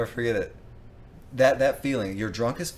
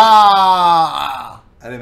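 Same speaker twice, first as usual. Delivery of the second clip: loud; 9 semitones higher, 19 dB louder than usual. Retold or imitated. Loud retching story outburst.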